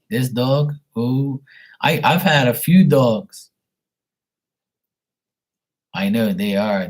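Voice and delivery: normal talking voice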